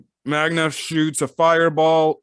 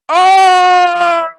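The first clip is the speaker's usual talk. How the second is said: long, high, loud "oh!" of shock; yelled high in amazement; 11 dB louder than usual. Real, not acted. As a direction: burst out with a loud, drawn-out shriek of surprise. Reacting to a surprise in the manga.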